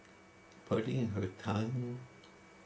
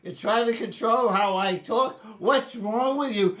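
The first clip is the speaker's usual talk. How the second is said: high, loud ranting; furious mother; 13 semitones higher, 11 dB louder than usual. Retold or imitated. Mother raging at her son about controlling her speech, loud high rant. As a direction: rail at him, loud and high. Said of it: "my beloved mother says".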